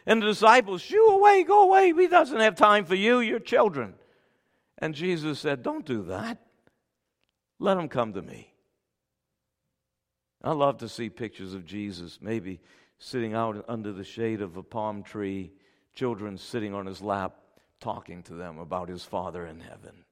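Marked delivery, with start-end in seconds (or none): 0.8-4.0 high, loud mock scolding; shooing disciples. Disciples chasing children away from Jesus, shooing. 5.6-8.5 soft, tender acted reproof; gentle welcome. Jesus telling disciples to let the children come, gentle. 10.4-20.1 low, soft, hushed sermon voice; tender preaching. Real, not acted. Preacher telling of Jesus's love for those Pharisees, tender.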